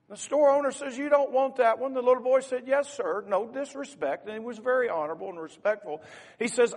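loud, high, impassioned story telling from the pulpit; fervent sermon storytelling. Acted. Preacher to congregation, telling the puppy story with loud fervor. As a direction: preach the story out with rising force.

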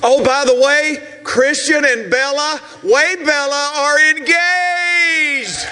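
big, drawn-out pulpit announcement; shouted, stretched-out key words; excited announcement. Real, not acted. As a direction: proclaim it to a cheering crowd. Announces an engagement to cheering crowd.

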